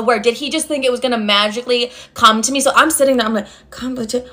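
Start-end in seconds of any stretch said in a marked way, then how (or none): 3.7-4.2 softly trying out syllables; sounding it out. Retold or imitated. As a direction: imitate a quiet, halting spelling attempt. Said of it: "I'm sounding it out".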